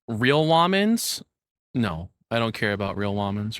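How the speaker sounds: ordinary talk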